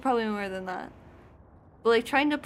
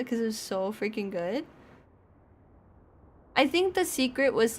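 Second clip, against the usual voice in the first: normal talking voice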